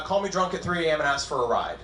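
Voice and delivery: usual speaking voice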